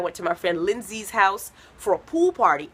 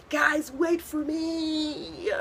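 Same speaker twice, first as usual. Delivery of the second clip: slow, softer whimpering cry; mock crying plea; 8 semitones higher, 4 dB quieter, much slower than usual. Comic as a sinking child begging friends to wait, mock crying. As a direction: plead tearfully as you sink. Drowning kid in pool.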